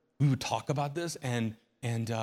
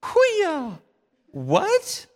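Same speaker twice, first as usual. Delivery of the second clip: sudden high yelp, then a squeaky high "What?"; startled yelp, then a high squeaky question; 9 dB louder than usual. Acted. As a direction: shriek it like you just got jolted. Preacher cries out in mock surprise.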